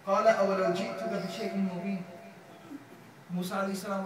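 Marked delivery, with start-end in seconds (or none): 0.1-2.0 loud, fast chanted verse; تلاوة; Quran recitation. Acted. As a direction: recite it firmly.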